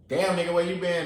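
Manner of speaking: loud confrontational call-out; sideways attitude. Retold or imitated. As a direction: snap at him like you're offended. Imitating someone coming at him sideways.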